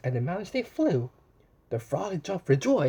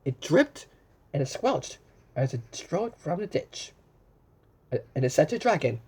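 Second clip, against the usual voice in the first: plain reading voice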